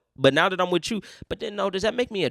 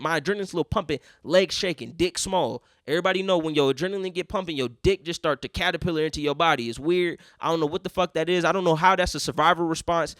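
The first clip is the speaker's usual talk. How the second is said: normal talking voice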